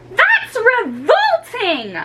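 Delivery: put-on high, slow, revolted girl's voice; disgusted girl. Jill to Ricky, high disgusted girl voice. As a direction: recoil in disgust.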